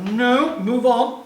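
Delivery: loud laugh, then slow; laughing it off, dragging out. Real, not acted. Laughs at own joke.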